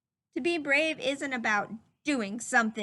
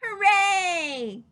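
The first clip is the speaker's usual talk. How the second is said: high, loud cheer of joy; 6 semitones higher, 8 dB louder than usual. A narrator's reading. Child cheering for the robin in the story, loud and high. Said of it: "clapped Chester".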